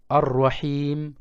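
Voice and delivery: ar-Rahim slow, the hiim held long; long vowel. Shown as a demonstration. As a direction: stretch the I of ar-Rahim. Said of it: "Je prolonge".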